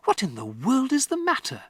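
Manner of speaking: a hint of alarm; concerned teacher. Miss Honey to Matilda, lightly concerned.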